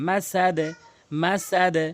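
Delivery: মাসাদে ending on a bounced d; qalqalah at a stop. Shown as a demonstration. Teacher showing the rule when pausing, bounced end of মাসাদে. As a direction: stop on মাসাদে with a short "e" echo. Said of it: "ধাক্কা দিয়ে পড়তে হবে".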